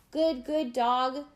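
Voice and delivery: slow, drawn-out encouraging holler. A narrator's reading. Tashawn encourages Spot from the boat, mild holler. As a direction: holler slow praise to the dog. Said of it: "hollers Tashawn".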